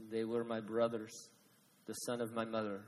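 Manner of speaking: put-on Gideon's words in a half-hearted accent; bad accent. Gideon telling the kings, in a bad put-on accent. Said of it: "it's a bad accent".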